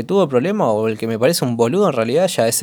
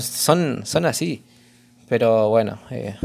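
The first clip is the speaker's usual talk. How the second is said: normal talking voice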